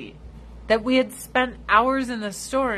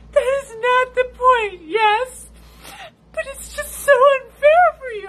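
imitating crying friend; 10 dB louder than usual. Friend on her period sobbing over shoe store unfairness. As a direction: blubber it out like a weepy friend. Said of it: "Fucking crying her eyes out".

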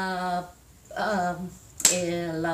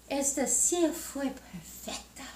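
whispered, pleased about the chair; 4 semitones higher, 5 dB quieter than usual. Acted. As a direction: breathe it out in a whisper. Whispered line in story.

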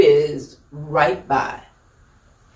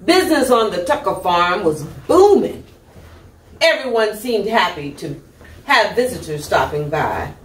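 ordinary delivery, nothing marked

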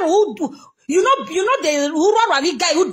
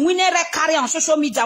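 ordinary talk

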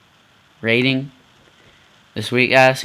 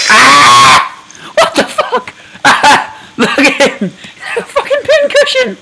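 much higher, laughing in disbelief; laughing shriek; 14 semitones higher, 5 dB louder, much slower than usual. Real, not acted. Gamer laughing at a pincushioned ragdoll, shrieking. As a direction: squeal with laughter at the sight.

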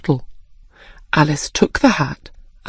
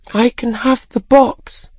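put-on higher, mildly sad child voice; sad little girl; 6 semitones higher than usual. A narrator's reading. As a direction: say it with a touch of sadness. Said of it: "said Alice sadly".

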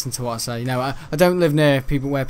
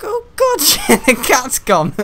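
high, loud, laughing shock; 10 semitones higher, 7 dB louder, slower than usual. Retold or imitated. Waking to find the cat gone, high laughing shock. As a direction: blurt it out mid-laugh, much higher. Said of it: "I'll be like".

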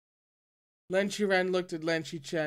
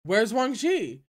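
louder, higher call across the place; raised voice; 6 semitones higher, 7 dB louder, slower than usual. A narrator's reading. Elder calling for his missing nephew, loud. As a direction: call out to find him. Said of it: "raised his voice".

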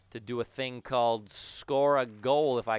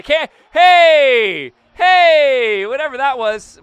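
much higher and louder, a startled protest; indignant shout; 11 semitones higher, 17 dB louder, slower than usual. Real, not acted. Gamer startled by the referee's call, shouting hey in protest. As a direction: yell hey at the sudden call. Penalty called on him.

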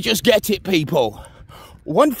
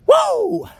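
high startled whoa; surprised whoa; 4 dB louder than usual. Real, not acted. Vlogger startled on the walk, high whoa. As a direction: yelp at a sudden surprise. Sudden exclamation mid walk.

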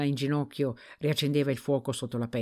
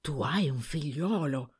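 stunned murmur. A narrator's reading. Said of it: "non faceva che ripetere".

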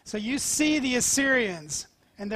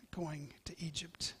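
whispered; 4 semitones lower, 15 dB quieter than usual. Acted. King's hushed prayer.

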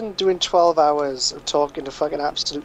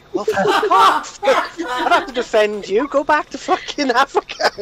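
high-pitched, laughing comeback; squealed, laughing mock indignation; mock outrage; 9 semitones higher than usual. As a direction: act offended while cracking up, pitch jumping up. Mock retort acted out through laughter.

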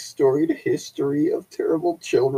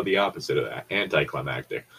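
deadpan; 10 semitones lower, 8 dB quieter, faster than usual. Real, not acted. Deadpan self-correction.

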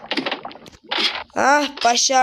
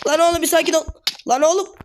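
real panic as the mob keeps hitting; panicked pleading; 3 semitones higher than usual. Real, not acted. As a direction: beg the mob to stop hitting you, half crying. Getting hit by zombie pigman.

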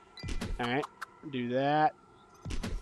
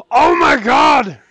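gasp, then loud, high, drawn-out yell; astonished shout; 7 semitones higher, 8 dB louder, much slower than usual. Real, not acted. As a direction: shout it in sheer amazement. Finds an online match.

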